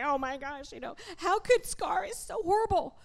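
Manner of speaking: mock dismay, pitch climbing high; retold upset reaction. Preacher retelling a first viewing of the movie, mock-upset. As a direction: exclaim it like an upset movie viewer, hammed up. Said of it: "I cried like a baby the first time".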